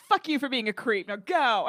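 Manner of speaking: loud, acted angry order; loud, indignant mock command; retold telling-off. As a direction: tell the creep off at volume.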